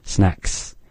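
Snacks ending in a soft breathy ks; teacher contrasting the ks sound. Shown as a demonstration. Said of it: "like in snacks".

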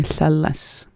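normal talking voice

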